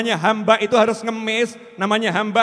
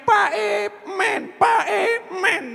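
slow high-pitched wheedle; mock childish whine; whining child; 11 semitones higher, much slower than usual. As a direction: imitate a small kid begging dad for candy, dragging out each word. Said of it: "anak kecil itu merengek".

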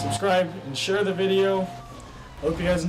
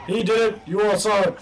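loud, raised-pitch accusation; accusing shout; 4 semitones higher, 5 dB louder than usual. Real, not acted. Gamer blaming someone before witnesses, accusing shout. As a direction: call out to every witness.

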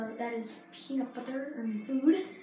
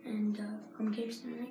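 soft unvoiced sign-off; whispering; 4 semitones lower, 6 dB quieter than usual. Real, not acted. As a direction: whisper the closing line.

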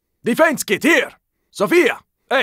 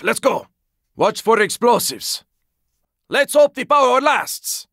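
ordinary talk, nothing marked

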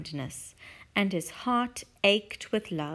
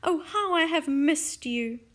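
tearful; 7 semitones higher, 5 dB louder than usual. Acted. Character greets beloved pet tenderly.

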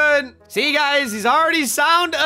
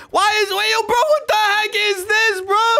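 high, panicky outburst; startled yelling, pitch way up; alarmed shout; 10 semitones higher, 4 dB louder than usual. Acted. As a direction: cry out in freaked-out disbelief. Dad alarmed by haunted room.

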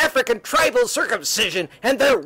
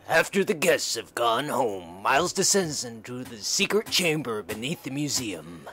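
lower, softer storyteller read; story narration; 13 semitones lower, 7 dB quieter than usual. A narrator's reading. Narrator leading listeners into the secret chamber, low storytelling voice. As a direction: let the narration sink low and hushed. Narrator between character lines.

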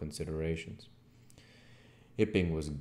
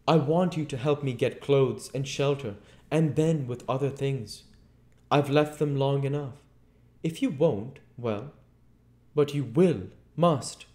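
raised, forceful voice making demands; insistent Invisible Man; 9 semitones higher, 8 dB louder than usual. A narrator's reading. Invisible man asks Marvel for clothes and shelter, insistent. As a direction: press hard, high and loud.

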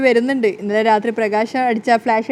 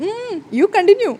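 sheepish; 9 semitones higher, much slower than usual. Acted. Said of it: "അയ്യോ, അബദ്ധം പറ്റി".

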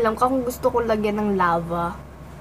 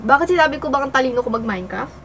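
usual speaking voice

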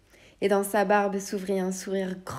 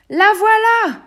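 much higher, loud shout of discovery; old man shouting with joy, pitched way up; triumphant cry; 14 semitones higher, 14 dB louder, slower than usual. Acted. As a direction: cry it out like you found treasure. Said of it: "s'écria le vieil homme".

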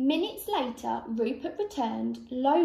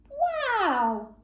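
high, drawn-out "wow"; amazed gasp; 9 semitones higher, 3 dB louder than usual. A narrator's reading. Rabbit sees duck's egg, amazed gasp. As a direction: breathe out amazement, pitched up. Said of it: "gasped Rupert".

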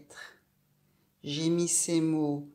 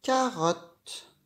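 "Carotte" revealed higher and louder; word revealed; 6 semitones higher, 3 dB louder than usual. Shown as a demonstration. Teacher revealing "Carotte" to the child, bright. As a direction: announce "Carotte" proudly. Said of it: "Ça fait quel mot?".

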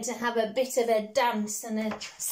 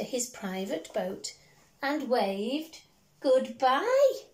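storybook reading; 2 semitones lower than usual. Acted. Parent reading a picture book to a child, gently lilting. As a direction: read it like a bedtime story.

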